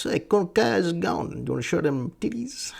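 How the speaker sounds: high-pitched mock catcallers; group of leering guys. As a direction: call out like a cartoonish heckler. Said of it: "just a group of guys".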